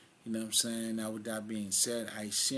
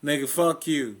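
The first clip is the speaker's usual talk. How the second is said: shouting an insult, much louder; retold shout; 4 semitones higher, 10 dB louder than usual. Retelling a man yelling an ethnic insult, loud shout. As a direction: yell it like a taunt across a room. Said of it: "like a motherfucker, yo, say".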